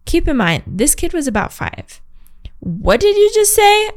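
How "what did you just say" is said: high, loud mock shout; playful shouted challenge. A narrator's reading. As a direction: shout it back at the kid. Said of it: "I shout".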